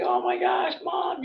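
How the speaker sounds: retold high-pitched cry of joy; happy exclamation. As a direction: imitate a daughter delighted to hear from her mom. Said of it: "she was so happy to hear".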